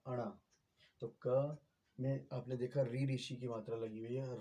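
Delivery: ordinary delivery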